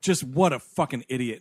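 ordinary talk

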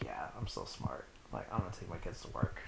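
low, unvoiced smug whisper from the dad. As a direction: whisper it like a self-satisfied father. Dad smugly agreeing, retold.

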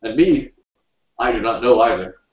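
mildly triumphant character voice. A narrator's reading. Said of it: "said triumphantly".

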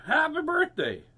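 high, loud happy shout; birthday greeting. Acted. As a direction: sing out the greeting at full voice. Turtle shouts greeting in story.

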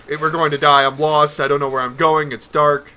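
ordinary talk

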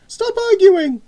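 very high, loud scolding snap; snapping. A narrator's reading. Mother breaking up her children's argument, snapping. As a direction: cut the squabble off sharply. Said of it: "snapped their mother".

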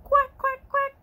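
high, loud duck quacks; happy duck quacking. Acted. Ducky showing children how happy sounds, high quacking. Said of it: "Ducky says".

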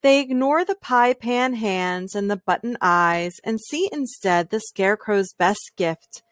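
ordinary delivery

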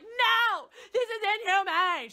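high, loud, hammed-up outraged wail; mock freak-out. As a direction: imitate an activist losing it in horror. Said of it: "she's freaking out because there are robot battles".

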